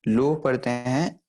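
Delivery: लो said short and slow, not लोआ; slow teacher's model of लो; correct pronunciation. Shown as a demonstration. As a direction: say लो slowly and clearly as one syllable. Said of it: "लोआ नहीं पढ़ते".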